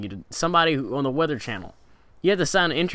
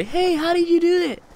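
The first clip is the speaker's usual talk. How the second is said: much higher, louder mock commenter asking; squeaky commenter voice; 4 dB louder than usual. Vlogger mimics a subscriber's question in a high mock voice. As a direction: play an eager fan asking how it was done. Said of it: "And they say".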